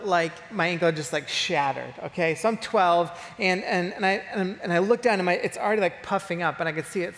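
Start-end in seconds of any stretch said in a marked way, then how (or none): none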